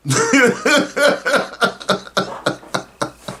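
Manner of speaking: a short soft chuckle; soft laugh. Real, not acted. Host chuckling at his own joke to the viewers. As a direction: let out a quiet laugh at your own joke.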